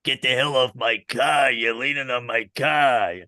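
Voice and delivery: put-on slow, raspy Brooklyn accent; raspy Brooklyn old-timer. Brooklyn old-timer telling someone off his car, raspy Brooklyn accent. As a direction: rasp it out in thick Brooklyn. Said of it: "really raspy voices".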